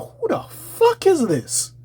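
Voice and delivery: high, slow baffled question. Retold or imitated. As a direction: squeak out total confusion. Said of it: "I'm like".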